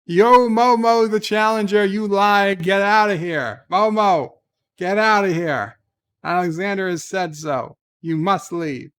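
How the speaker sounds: loudly ordering Momo out, playfully; hammed-up loud threat. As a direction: play it like a theatrical bouncer. Reading a mock threat superchat.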